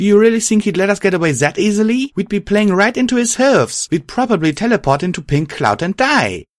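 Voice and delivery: high, urgent objection; high-voiced heroine shooting down the idea; exasperated young heroine. A narrator's reading. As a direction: argue it back heatedly, pitch well up.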